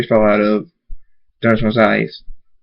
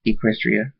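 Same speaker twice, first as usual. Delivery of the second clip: soft farewell; 6 dB quieter than usual. A narrator's reading. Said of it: "Tears fall from Twilight's eyes".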